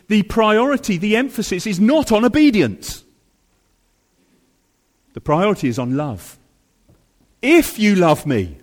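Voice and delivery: full-force preaching. Acted. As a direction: preach it with rising passion.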